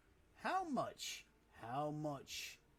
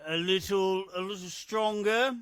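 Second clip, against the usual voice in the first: a little stronger, said louder and higher; punchy repeat; 6 semitones higher, 11 dB louder than usual. Acted. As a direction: hit it hard and bright, like announcing a power-up. Repeating the character's line.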